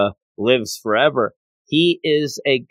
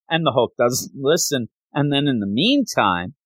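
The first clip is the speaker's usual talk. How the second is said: usual speaking voice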